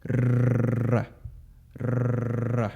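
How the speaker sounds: long rolled Spanish r; rolled r trill. Shown as a demonstration. Teacher demonstrating the trilled Spanish r. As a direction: roll the r hard. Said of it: "pronounced as a trill".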